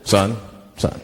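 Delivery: cop voice. Acted. Police officer at a traffic stop, deep stern voice. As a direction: drop it low like a traffic cop.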